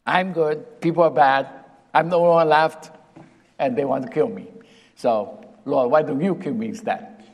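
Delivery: mocking paraphrase of Elijah; mock complaint. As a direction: parody a sulking prophet.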